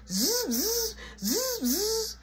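high buzzing drill imitation; drill noise. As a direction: buzz like a screwdriver drill.

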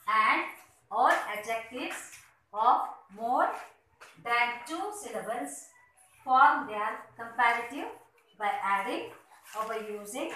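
normal talking voice

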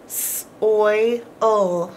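"S-, oy, ul" in three parts; slow, sound by sound. Shown as a demonstration. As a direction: split the word into sounds. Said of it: "Three sounds".

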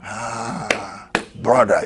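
ordinary talk, nothing marked

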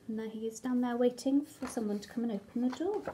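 usual speaking voice

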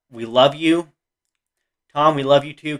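ordinary talk, nothing marked

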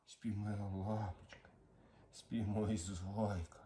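slow, soft and tender; slow hushing to calm a pet; soothing lullaby tone. Real, not acted. Lulling the dog to sleep.

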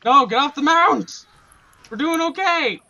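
loud, high-pitched yelling; urgent shout. Real, not acted. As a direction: yell the order at a teammate. Yelling at teammate to dismount.

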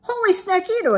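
high, loud kitten exclamation; exclaiming. A narrator's reading. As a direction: cry out in delighted surprise. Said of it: "exclaimed the kitty boy".

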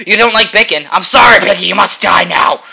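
loud, high mock-dramatic villain cry; hammed-up villain. Gamer kills the pig, hammed-up villain. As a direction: ham up the pig slaughter.

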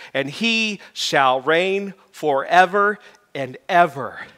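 loud, slow, swelling proclamation; grand and drawn out, each phrase ringing; Messiah-style proclamation. Real, not acted. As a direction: proclaim it like an oratorio chorus. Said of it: "go back to the Messiah".

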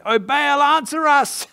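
high, loud cry up to a god; shouting. Acted. Prophets calling on Baal to answer them, shouting. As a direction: call out at full voice, pleading for an answer. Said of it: "we read, shouting".

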